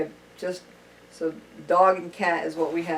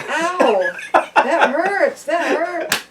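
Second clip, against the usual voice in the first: high, loud robotic toy voice; computerized toy voice; 9 semitones higher, 8 dB louder, much slower than usual. Retold or imitated. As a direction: squeal it like a talking toy with a laugh. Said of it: "computerized voice".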